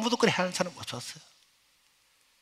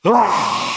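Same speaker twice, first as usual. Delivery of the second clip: burst of crying; 4 semitones higher, 9 dB louder, much slower than usual. Retold or imitated. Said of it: "울음이 터지는데".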